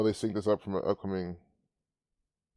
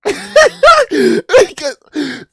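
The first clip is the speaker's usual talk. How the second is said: derisive laugh; 13 dB louder than usual. Real, not acted. Laughs at reporter's dry eyes.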